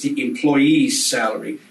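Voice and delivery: "employee's" said slowly and deliberately; slow down and land on "employee's"; stress on employee's. Shown as a demonstration. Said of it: "Notice I said employees".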